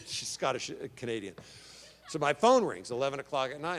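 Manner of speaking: normal talking voice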